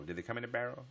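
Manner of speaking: usual speaking voice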